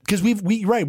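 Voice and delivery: ordinary talk, nothing marked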